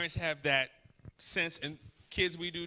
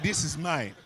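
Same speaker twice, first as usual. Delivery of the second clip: mock possessive wife. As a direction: claim your man and warn him off. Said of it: "she like".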